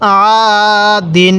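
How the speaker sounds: "আ" held out, then short "দিন"; one-alif madd. Shown as a demonstration. Teacher shows the empty-alif rule, stretched "আ" before "দিন". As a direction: draw out the "আ" before saying "দিন". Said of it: "এরকমভাবে শিখব".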